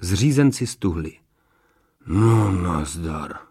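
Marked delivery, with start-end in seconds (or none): 2.1-3.4 very slow, low sighed words; dismayed sigh. A narrator's reading. Workman facing the stone group, sighing in dismay. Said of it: "povzdechl si zřízenec".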